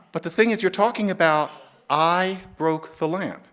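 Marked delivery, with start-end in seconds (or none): 1.9-3.4 slow, deliberate "I broke the lamp"; slow demo. Shown as a demonstration. Showing listeners the accepted sentence, slow and deliberate. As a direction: lay out "I broke the lamp" slowly as the proper example. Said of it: "Now, no, you wouldn't say".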